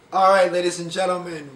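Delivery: loud showman intro; announcer call. Acted. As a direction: boom it out like a host. Announcing the roundup segment.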